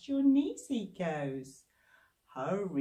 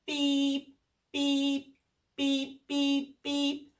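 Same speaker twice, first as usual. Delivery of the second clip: higher, chirpy beeping; car horn beeps; 6 semitones higher, much slower than usual. Acted. Children's leader imitating the jeep horn. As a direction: beep like a toy horn.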